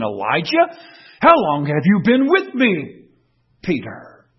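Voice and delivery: fervent preacher's call; full-force preaching. Acted. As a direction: thunder it from the pulpit.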